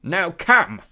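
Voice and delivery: loud high giant shout; cyclops shout. A narrator's reading. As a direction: bellow the order. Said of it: "he shouted".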